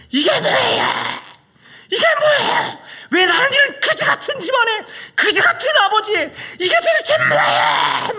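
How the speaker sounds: shrieking high and loud, half sobbing; screaming at God. Retold or imitated. A son screaming at God in the church, furious shout. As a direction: cry out to God in raw fury, voice cracking. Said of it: "막 소리 질렀어요".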